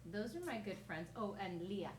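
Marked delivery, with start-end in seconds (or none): none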